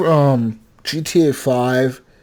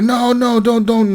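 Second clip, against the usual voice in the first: crying plea; 8 semitones higher, slower than usual. Retold or imitated. Terrorist's family begging the soldiers, imitated crying plea. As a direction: imitate a frightened family pleading. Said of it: "they're crying".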